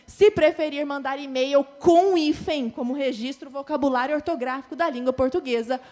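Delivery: emphatic reading. Shown as a demonstration. Teacher reading corrected email loudly. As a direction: read the fixed email with force. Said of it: "teacher reading corrected text emphatically".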